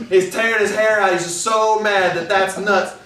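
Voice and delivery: ordinary talk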